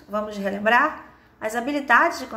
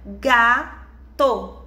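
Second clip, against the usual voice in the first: "Gato" broken into ga, to, each loud and held; syllable by syllable; 4 dB louder than usual. Shown as a demonstration. Teacher showing a child the syllables to count, split slowly. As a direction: split "Gato" into two slow beats, GA - TO. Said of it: "Quantas vezes a gente abre a boca".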